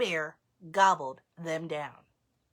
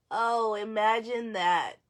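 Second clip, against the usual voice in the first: ordinary delivery, nothing marked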